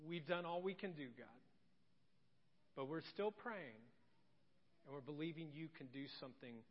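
soft, low, hushed pleading prayer. Retold or imitated. Pastor voicing a family praying for someone, hushed prayer. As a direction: murmur it like a quiet family prayer.

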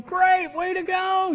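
a sarcastic pat on the back; mock cheer. Sarcastic aside about his wife.

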